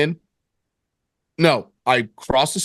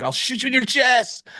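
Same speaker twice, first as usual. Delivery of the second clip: higher-pitched put-on menace; mock robber threat; 9 semitones higher than usual. Would-be robber trying out lines, mock menacing.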